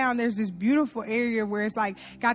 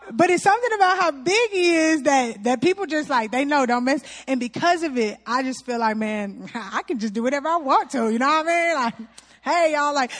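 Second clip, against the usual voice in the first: ordinary delivery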